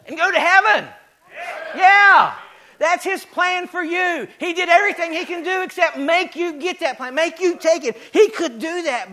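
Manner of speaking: high, loud, driving sermon delivery; full-force preaching. Acted. Pastor pressing God's plan on the congregation, at full force. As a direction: preach it hard to the pews.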